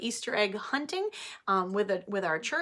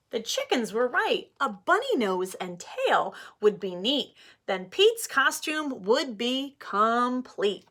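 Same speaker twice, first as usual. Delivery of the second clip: put-on reading to children; 3 semitones higher, 4 dB louder than usual. Teacher reading Pete the Cat aloud to her class, animated. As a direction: read it like story time for little ones.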